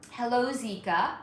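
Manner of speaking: ordinary delivery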